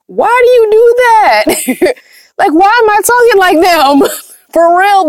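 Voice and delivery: high-pitched, laughing disbelief; amused exasperation. Real, not acted. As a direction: question your own silly habit, voice shooting up. Baffled by her accent mimicking.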